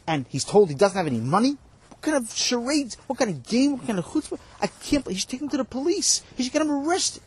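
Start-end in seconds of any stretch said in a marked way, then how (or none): 1.9-7.2 much higher, indignant disbelief; can't believe the nerve, pitch climbing; student protesting to the rebbe, high and outraged. Real, not acted.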